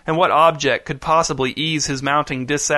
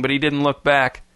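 ordinary delivery, nothing marked

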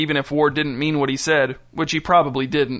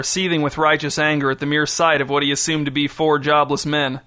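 plain reading voice

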